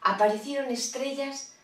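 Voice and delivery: ordinary delivery, nothing marked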